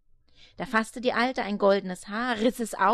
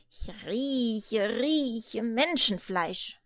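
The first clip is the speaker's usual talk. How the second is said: slow, sniffing devil voice; much slower than usual. Acted. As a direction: drag it out, sniffing the air.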